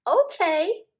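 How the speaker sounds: high, loud glad yes; happy child. A narrator's reading. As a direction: agree happily. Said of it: "said Jim".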